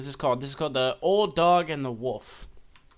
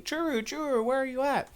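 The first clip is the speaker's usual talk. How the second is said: put-on much higher little boy voice calling out; 11 semitones higher than usual. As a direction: call for the lost dog like a small child. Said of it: "he's running around saying".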